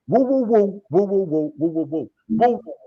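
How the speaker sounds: rapid bursts of high woos; high, put-on rhythmic wooing; imitated woo-woo noises. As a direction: fire off repeated high woos. Imitates taking control with noises.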